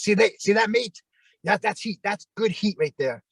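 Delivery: put-on New Zealand accent, gleefully praising the heat; Kiwi wrestler voice. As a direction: imitate the Bushwhackers' New Zealand twang, pleased. Bushwhackers quoted, Aussie-style mate.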